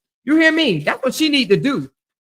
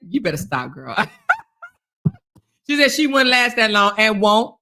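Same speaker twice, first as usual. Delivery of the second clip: ordinary talk, nothing marked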